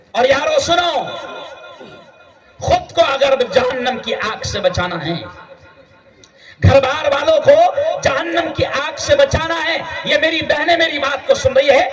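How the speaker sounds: impassioned sermon shout; fiery preaching. Acted. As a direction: rouse the congregation. Preaching at full force.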